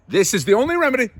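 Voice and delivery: loud, high, fast outburst; emphatic proclamation. Acted. Astrologer insisting on his one cure, loud proclamation. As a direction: burst out with absolute certainty.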